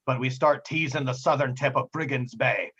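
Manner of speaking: put-on old sea captain explaining the routes; pirate captain, weighty. Captain telling his crew of three routes, weighty pirate voice. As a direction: voice a seasoned pirate, measured and emphatic.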